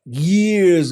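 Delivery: YEARS stretched out very slowly; drawn-out storytelling. Real, not acted. Resuming the story years later, "Years" hugely drawn out. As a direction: linger long on "Years" for dramatic effect. Dramatic repeat, drawn out for effect.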